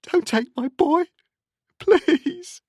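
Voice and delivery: loud, very high anguished plea; begging. A narrator's reading. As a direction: beg desperately for your son. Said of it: "she begged".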